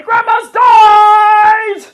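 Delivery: very loud, slow, high shout; child screaming. Retold or imitated. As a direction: scream it through the house like a small boy. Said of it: "just screaming".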